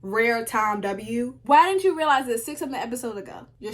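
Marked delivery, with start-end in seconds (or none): none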